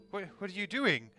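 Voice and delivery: ordinary delivery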